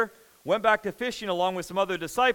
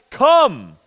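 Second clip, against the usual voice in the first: loud, higher calling out; urgent summons; 6 semitones higher, 9 dB louder than usual. Acted. Jesus inviting Peter to come, loud urgent call. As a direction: call them in with force. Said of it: "it's like".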